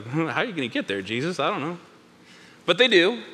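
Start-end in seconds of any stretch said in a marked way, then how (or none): 0.0-1.8 doubtful muttering. Acted. Disciples puzzled by Jesus' plan, doubtful muttering. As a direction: mumble your puzzlement.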